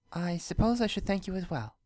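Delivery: a shy, pleased princess greeting; Luna voice. A narrator's reading. Luna greets Spike, lightly voiced and shy.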